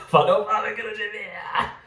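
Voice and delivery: high, quick, laughing through the words. Real, not acted. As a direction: crack up over your own slip. Laughing after an accidental rude word. (rindo sem conseguir segurar)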